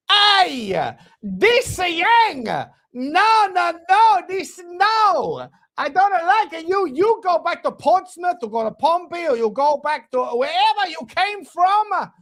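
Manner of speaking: high, loud put-on Italian accent, animated; Italian impression. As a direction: do a hammed-up Italian coach accent. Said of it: "it was an Italian impression".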